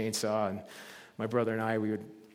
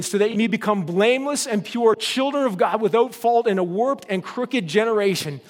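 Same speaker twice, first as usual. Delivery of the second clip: louder, higher scripture proclamation; forceful preaching; 7 semitones higher, 7 dB louder than usual. Acted. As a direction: proclaim it to the whole congregation.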